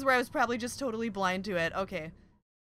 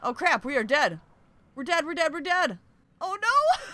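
loud, rising alarm; panicked; 4 semitones higher, 6 dB louder than usual. Real, not acted. Player realizing we are dead, panicked. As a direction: build from dismay to a high yelp. Oxygen out, dying in game.

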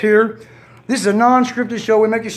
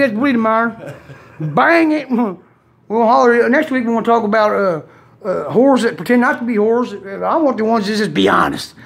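usual speaking voice

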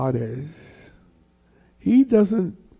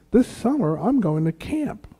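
higher-pitched mock childish excitement; retold kid's eagerness; excited child; 7 semitones higher than usual. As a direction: announce it like a little girl with big news. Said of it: "she made it sound so great".

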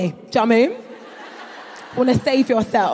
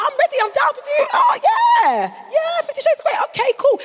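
eager yes; 11 semitones higher than usual. Retold or imitated. Comedian mimicking her own eager yes to role play. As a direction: gush eagerly, way up high and fast. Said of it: "I was like".

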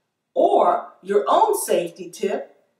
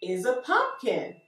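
put-on reading to a toddler; 4 semitones higher, slower than usual. Teacher reading to small children.

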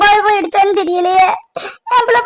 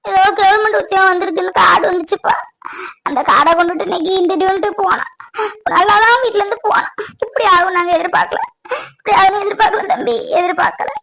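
tearful, lamenting account; crying. Acted. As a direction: tell it between sobs. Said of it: "அழாதீங்க அத்தை". (அழுதுகொண்டே புலம்பும் குரல்)

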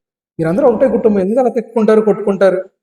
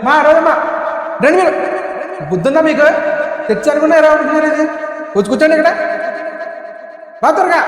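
angry yelling; 8 semitones higher than usual. Acted. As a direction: yell at them to come and sit, furious. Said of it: "ఎందుకు అలా అరుతో దెయ్యం పట్టింది అలాగా".

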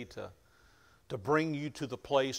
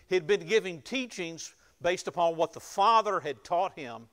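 louder, higher fervent preaching; preaching at full force; 6 semitones higher, 8 dB louder than usual. Acted. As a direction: drive the point home to the congregation. Preaching builds up.

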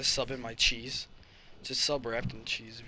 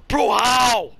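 sharp gasp, then loud high outcry; incredulous yell; 10 semitones higher, 15 dB louder than usual. Real, not acted. Gamer protests a shot that should've hit, incredulous yell. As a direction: yell in disbelief at a missed hit.

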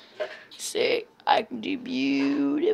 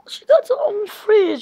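high, loud delighted squeal; excited; 11 semitones higher, 9 dB louder than usual. Pretend. Amazed discovery inside mind.

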